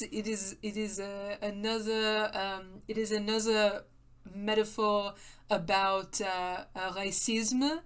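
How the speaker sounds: lightly put-on mock French accent, halting and hesitant; bad French accent. Gamer rambling about androids in a bad mock French accent. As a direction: play a pretentious French critic in a deliberately bad French accent. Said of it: "it's a good mock French accent".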